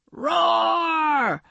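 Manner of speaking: high wailing roar; monster roar. A narrator's reading. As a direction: let out a big beast roar. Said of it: "said the sea monster".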